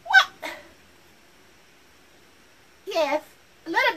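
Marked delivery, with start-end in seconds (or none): 0.0-0.5 high disbelief. Acted. Reacting again, higher.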